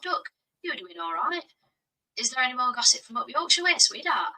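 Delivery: put-on a light Yorkshire accent, friendly and chatty. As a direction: greet him like a cheery Yorkshire local, a hint of Yorkshire. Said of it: "Can you do Yorkshire, Yorkshire accent".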